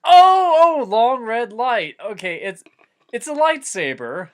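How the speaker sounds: high, loud sudden realization; real delight at working it out; excited realization. Real, not acted. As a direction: blurt it out as it finally clicks. Realizing the object is a lightsaber.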